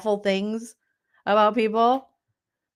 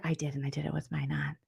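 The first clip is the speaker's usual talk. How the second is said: fast breathless whisper, much softer; 8 semitones lower, 12 dB quieter, faster than usual. Retold or imitated. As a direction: whisper it quickly like a secret confession. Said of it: "And Kelly's like".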